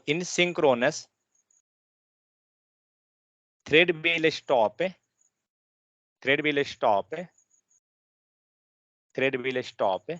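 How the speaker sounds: slow dictation with phrases repeated; dictating. Shown as a demonstration. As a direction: pause for students to write. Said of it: "Write down synchronous".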